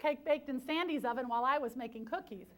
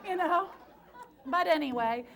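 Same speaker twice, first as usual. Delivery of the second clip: louder, laughter still in the voice; laughing; 4 semitones higher, 7 dB louder than usual. Real, not acted. Speaker moves on with the audience, still laughing. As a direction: wind down the laugh as you move on.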